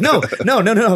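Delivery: head off the friend's bad idea; mock alarmed refusal. Hastily talks friend out of it.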